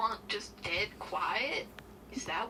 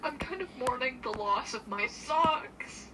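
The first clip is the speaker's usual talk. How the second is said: mock sorrow; 7 semitones higher than usual. Mock grieving over socks.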